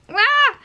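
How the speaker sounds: high delighted squeal; excited squeal. Real, not acted. Fan mail gift for her kids, a high squeal.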